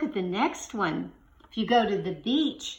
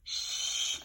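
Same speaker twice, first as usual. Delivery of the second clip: long shh sound; 10 dB quieter than usual. Shown as a demonstration. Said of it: "What do you think it begins with?".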